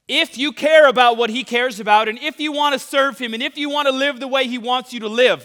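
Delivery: loud, high-energy sermon; preaching. Acted. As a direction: preach it with fire. Sermon back at full force.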